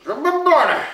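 loud taunt. Acted. Repeating taunt, mouth full.

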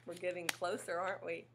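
usual speaking voice

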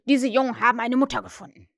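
loud, fast agitated cry; agitated outburst. Acted. Hook tells his pirates the news, agitated loud outburst. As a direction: spill it out fast, upset. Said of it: "in heftiger Erregung".

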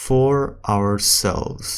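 slow, spaced-out English; slow practice reading. Shown as a demonstration. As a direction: read slowly for learners, pausing between phrases. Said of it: "السرعة الثالثة اللي هي الطبيعي".

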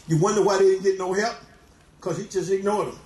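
normal talking voice

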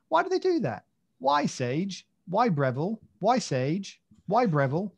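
high, loud, hammed-up exasperation; exasperated questioning. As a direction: plead in mock outrage at the makers. Acts out his exasperated thoughts.

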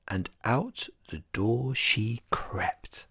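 slow, hushed whisper as she sneaks out; whispered narration. A narrator's reading. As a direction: tiptoe the words out in a whisper. Sneaking out at night.

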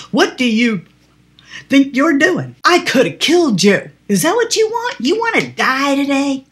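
hammed-up outrage at the cop; indignant outburst. Driver scolding the policeman who stepped into the road, loud and indignant. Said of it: "And I very indignantly said".

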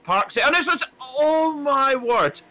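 a real gasp of astonishment at a near crash; excited commentary. Real, not acted. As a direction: voice leaps high and loud, stretching out oh my word. Crash nearly happens live on track.

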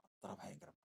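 whispered in awe; awed whisper. Acted. Preacher whispers to the church how great tears are. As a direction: breathe it out in wonder.

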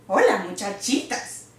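ordinary delivery

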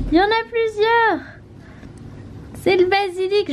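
high, delighted gasping wonder; excited. Real, not acted. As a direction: marvel at the tiny sprouts. First basil sprouts coming up.

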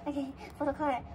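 usual speaking voice

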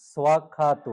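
স্বাক্ষাত said clearly with a swa sound, no final u; correct Pali pronunciation. Shown as a demonstration. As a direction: model স্বাক্ষাত syllable by syllable for students. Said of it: "উচ্চারণ". (স্বাক্ষাত স্পষ্ট উচ্চারণে)

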